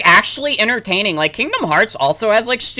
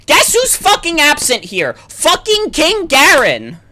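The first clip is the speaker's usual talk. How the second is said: louder, higher furious complaining; angry rant; 7 semitones higher, 5 dB louder than usual. Real, not acted. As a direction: vent at the missing king. Angry rant at missing king.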